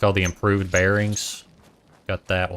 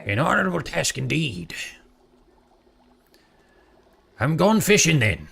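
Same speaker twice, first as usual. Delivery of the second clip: put-on gruff old sea-dog pirate accent, pitched way up; crusty old pirate; 7 semitones higher than usual. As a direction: play the salty old captain's mate. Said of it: "crusty old pirate".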